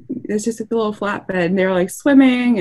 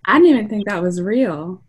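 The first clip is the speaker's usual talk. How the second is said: ordinary talk, nothing marked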